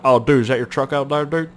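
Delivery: mock Canadian truck-guy accent; put-on Canadian accent. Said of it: "you have to say it with that accent".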